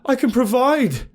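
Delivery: high, loud elated cries; ecstatic shouts. Acted. Narrator sprinting around the beast, ecstatic shouting.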